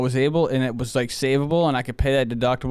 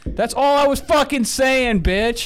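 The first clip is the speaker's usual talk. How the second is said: higher, louder, slow exasperated outburst; 10 semitones higher, 4 dB louder, slower than usual. Real, not acted. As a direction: lay it out slowly, fed up with them. Exasperated at not being understood.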